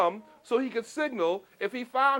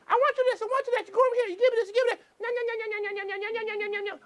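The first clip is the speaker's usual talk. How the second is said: much higher bossy aunt voice; nagging aunt; 12 semitones higher, much faster than usual. Retold or imitated. Boy's aunt ordering him about, high nagging voice. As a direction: nag like a fussy aunt who never stops. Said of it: "All day long she'd be".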